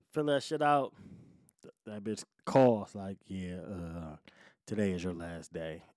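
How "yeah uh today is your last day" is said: low, breathy-quiet, reluctant delivery; hushed retold call. Boss calls to say it's your last day, hushed. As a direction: imitate the caller, near whisper.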